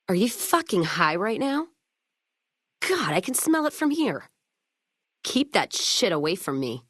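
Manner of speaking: rising into a disgusted near-shout, pitch climbing high; disgusted outburst. Acted. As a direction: call them out, revolted and fed up. Companion smells drugs on player.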